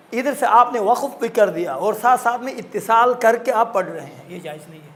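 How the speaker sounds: ordinary talk, nothing marked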